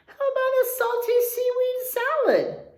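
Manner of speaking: high, bright suggestion in a character voice; cheery turtle voice. Acted. Gary the turtle suggesting lunch to his friend, high eager voice. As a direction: offer the salad eagerly as Gary. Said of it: "Gary suggested".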